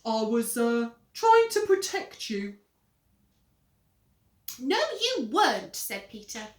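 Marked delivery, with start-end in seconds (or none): 0.0-2.6 oily innocence; smooth. Acted. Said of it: "Henry said smoothly". 4.6-5.8 high, loud whine; tattling little brother. Acted. Peter threatening to tell Mum, high tattling whine.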